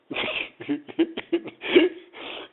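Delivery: high, loud burst of laughter; éclat de rire. Real, not acted. Rit de sa propre blague. (grand rire aigu)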